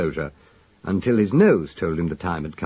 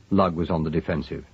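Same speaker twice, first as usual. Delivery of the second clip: ordinary delivery, nothing marked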